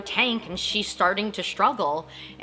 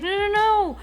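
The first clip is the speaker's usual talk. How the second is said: pleading denial; 10 semitones higher, faster than usual. Retold or imitated. Dustin denying Eddie is dying.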